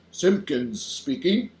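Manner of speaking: slow, lightly self-important phone greeting; very important telephone voice. A narrator's reading. Father answering the telephone, mildly self-important. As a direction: draw it out with a touch of importance. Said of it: "very important telephone voice".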